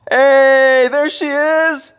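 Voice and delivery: lightly voiced high medic calling out; squeaky high medic voice, hammed up; high mock medic. Mock medic calling to the patient, high. Reacting to video, voicing the medic.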